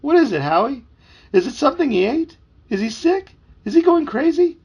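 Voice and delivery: put-on high, loud worried boy; rising panic, question after question; frantic questioning. A narrator's reading. Boy begs his dog to explain dad's behaviour, high and frantic.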